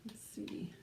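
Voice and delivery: soft whispered aside. Real, not acted. Whispering to a child before the page turn. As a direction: whisper it like a secret.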